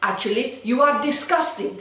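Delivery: mock Spanish girl laying into someone; angry Spanish girl. Spanish girl starts on the seventeen-year-old, mock scolding. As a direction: mimic her attack, pitch rising at the end. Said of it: "I heard Spanish girl start on".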